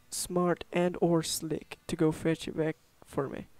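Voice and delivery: put-on cowboy lawman recounting his troubles; folksy western sheriff. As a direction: play a small-town sheriff asking for help. Voices the game's sheriff.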